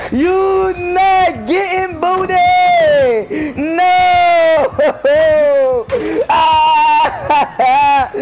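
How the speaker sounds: high, drawn-out wailing shouts; loud, high hysterical outburst; frantic screaming. Real, not acted. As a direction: scream in protest with laughing cries. Caught while fleeing in game.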